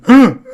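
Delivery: sharp, loud, high gasp of shock; imitated gasp. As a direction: gasp as if hit by the story. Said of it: "They're all going".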